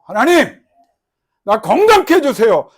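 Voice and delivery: loud, high plea; earnest prayer. Acted. Believer asking God for health, loud plea. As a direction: call out to God. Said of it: "believer praying for health".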